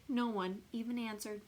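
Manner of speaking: usual speaking voice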